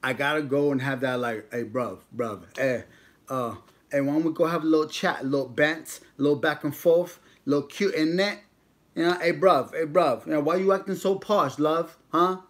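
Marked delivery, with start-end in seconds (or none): 1.5-8.4 mock London street accent, chatty. 9.2-12.4 louder, higher mock London street accent. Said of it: "I gotta do that accent".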